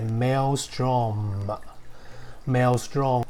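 Maelstrom drawn out slowly with a clear final M; slow model pronunciation. Shown as a demonstration. Teacher modelling Maelstrom slowly for the class. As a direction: say Maelstrom slowly, landing the last M. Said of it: "所以这个字是念".

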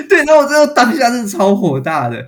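recounting how furious she was, laughing. Real, not acted. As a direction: laugh out the anger. Still laughing, recounting anger.

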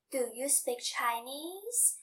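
"Do you speak Chinese?" slow, pitch climbing on "Chinese?"; rising question tune. Shown as a demonstration. Teacher showing learners a question, slow rising tune. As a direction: ask "Do you speak Chinese?" slowly, letting the tune rise at the end. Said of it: "we use rising intonation".

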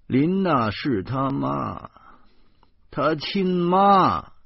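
slow, drawn-out, rising loud and high; pained emphasis. A narrator's reading. Husband admitting Linna is the real mother, pained. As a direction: stretch each word out in loud protest.

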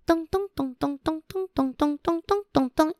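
high, soft chanted footsteps; footstep sounds. Acted. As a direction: tap out little footsteps. Footsteps sound in picture book.

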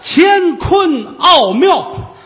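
slow, high and loud proclamation; drawn-out proud shout. Acted. Old immortal proclaiming his gourd's name, slow loud shout. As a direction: stretch every syllable like a grand title.

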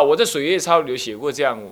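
ordinary delivery

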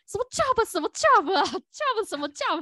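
high, alarmed "什么job" to herself; panicked inner voice. Retold or imitated. To herself after the mistake, high panicked inner voice. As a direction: panic inside at her own slip. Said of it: "心里就突然就说".

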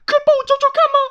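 high, loud, stammering panic; flustered hen pleading for help in a shrill rush; frantic, stuttering high voice; anxious mother hen. Acted. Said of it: "鸡妈妈很焦急地说".

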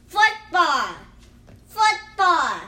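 ordinary delivery

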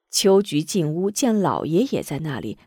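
usual speaking voice

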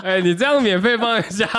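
loud, high, laughing jab; laughing tease. Real, not acted. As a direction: tease through a burst of laughter. Teasing co-host, laughing loudly.

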